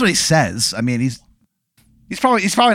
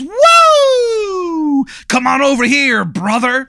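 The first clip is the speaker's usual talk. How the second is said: high, loud wrestler taunt; hammed-up wrestler; 11 semitones higher, 3 dB louder, slower than usual. Wrestler calling the guest into the ring, in a high loud taunt. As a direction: roar it like a pro wrestler.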